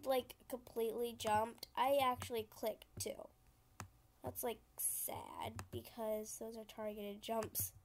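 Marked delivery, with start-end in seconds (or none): 1.8-7.7 hushed whisper. Real, not acted. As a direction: whisper it to yourself. Whispered gaming aside.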